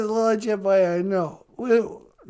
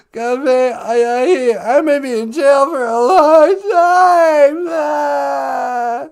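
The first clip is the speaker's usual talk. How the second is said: mock wail; 6 dB louder, slower than usual. Juicy wailing about jail.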